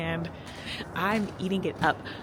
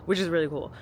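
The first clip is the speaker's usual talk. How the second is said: ordinary delivery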